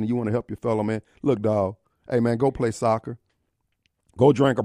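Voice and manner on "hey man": ordinary delivery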